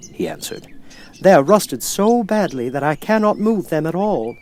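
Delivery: a rusted man grateful to be heard; Tin Man. Acted.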